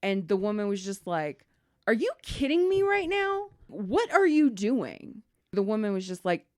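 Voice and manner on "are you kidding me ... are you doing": outraged disbelief. Retold or imitated. Daughter's outraged reaction.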